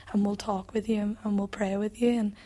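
normal talking voice

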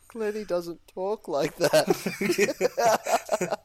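very high, very slow, laughing imitation; retelling a mate's deadpan line in a silly high voice; mock quote. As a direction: stretch every word in a squeaky mock voice. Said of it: "he goes".

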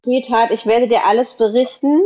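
ordinary delivery

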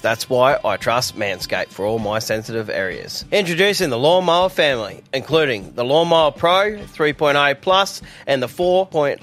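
steady sponsor read over music; a light radio ad voice. Acted. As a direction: pitch the product to listeners. Reads a sponsor ad.

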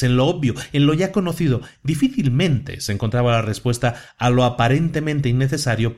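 plain reading voice

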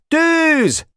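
very high firing order; shouted command. A narrator's reading. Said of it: "hogy elkiálthassa magát". (magas hangon kiáltott vezényszó)

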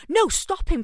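high, urgent begging; panicked plea. Retold or imitated. Daughter begging mum on the phone, panicked. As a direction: beg her to stop him.

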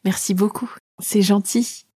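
put-on small, sweet little-girl voice. A narrator's reading. Girl charming the dragon, small coaxing voice. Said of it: "en prenant une petite voix".

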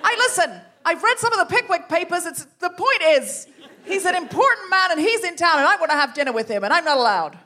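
high, loud, indignant rallying cry; rousing ringleader. Acted. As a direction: stir up the club against the press men. Improv as Jenny June.

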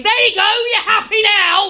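high, loud mock-angry yell; hammed-up indignant shouting; defiant shout. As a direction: yell it like fed-up kids at a teacher. Acting children confronting their teachers.

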